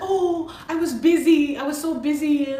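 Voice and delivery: high, put-on excuse-making voice; mocking excuse. Mimicking the lady's excuses, scornfully.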